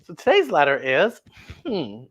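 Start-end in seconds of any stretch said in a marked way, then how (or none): none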